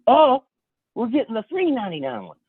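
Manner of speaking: high-pitched, eager decision; eager friend imitation. Friend choosing the bargain box, acted eagerness. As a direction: jump up high on the first word, acting delighted. Friend picks the cheaper cereal.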